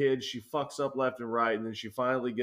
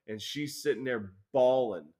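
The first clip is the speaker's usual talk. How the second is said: ordinary talk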